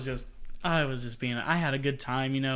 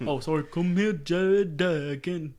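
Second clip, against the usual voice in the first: dragged, slow put-on accent on "Jared Duggan"; a retry of the mocking Embiid voice; Joel Embiid impression; 2 semitones higher, slower than usual. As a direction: stretch the name in a mock accent. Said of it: "I shouldn't have done that".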